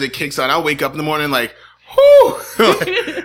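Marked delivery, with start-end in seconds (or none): 2.0-2.4 high, loud exasperated whew; mock relieved whew. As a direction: blow it out like waking up sweating. Waking up overheated.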